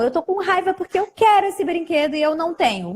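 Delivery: put-on a light child voice, fast. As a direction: rattle it off like a kid who wants the toy. Child explains wanting toy.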